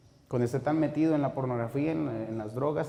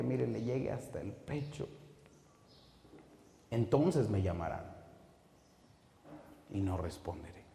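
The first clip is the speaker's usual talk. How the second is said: hushed warning; 3 semitones lower, 8 dB quieter than usual. Acted. Grave warning.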